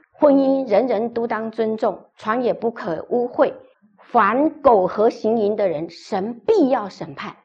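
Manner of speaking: slow, weighty recitation of scripture; stern scripture. Shown as a demonstration. Pastor reciting a stern verse to the couple, slow and weighty. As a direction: spell out each word like a verdict. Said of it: "很严厉的话".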